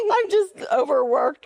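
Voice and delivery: high, laughing through the words; helpless giggles while excusing yourself; giggly. Real, not acted. Host excusing her silly outburst to co-host, laughing.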